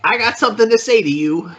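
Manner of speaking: playing a shabby would-be informant trying to sound businesslike; Krisa character voice.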